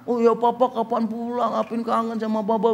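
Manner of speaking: put-on high, thin child missing dad; little son's voice. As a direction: plead like a small boy on the phone. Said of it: "Apin, ini papa".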